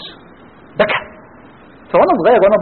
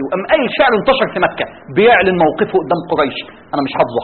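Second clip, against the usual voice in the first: ordinary delivery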